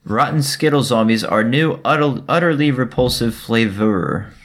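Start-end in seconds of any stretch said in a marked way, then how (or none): none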